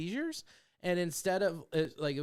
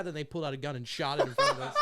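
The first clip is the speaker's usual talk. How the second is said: ordinary talk, nothing marked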